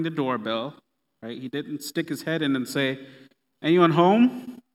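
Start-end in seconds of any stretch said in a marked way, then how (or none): 3.6-4.6 loud, drawn-out call with rising pitch. Acted. Visitor sticks his head in the door, calling out. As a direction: call into a house to see who's in.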